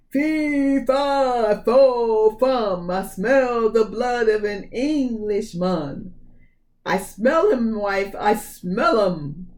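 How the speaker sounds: loud giant's chant, slowing to a sniffing growl; ogre roar. Acted. Ogre sniffing the air for Jack, booming. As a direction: boom it out, then sniff him out slowly.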